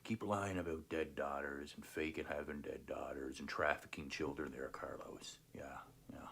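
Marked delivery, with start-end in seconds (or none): 4.7-6.3 mocking whisper; breathy dismissive whisper. Real, not acted. Taunting rival in a whisper.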